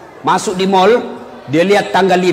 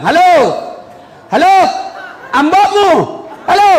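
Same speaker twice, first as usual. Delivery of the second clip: mock phone shouting; 9 semitones higher than usual. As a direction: bellow down the line like an old villager. Old Haji on payphone, wrong.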